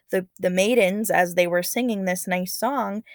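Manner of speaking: usual speaking voice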